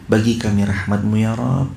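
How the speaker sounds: ordinary talk, nothing marked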